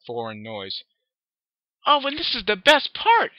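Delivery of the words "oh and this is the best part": very high, loud squeal; happy squeal. Acted. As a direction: squeal it with joy. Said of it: "she squealed happily".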